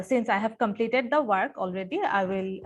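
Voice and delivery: usual speaking voice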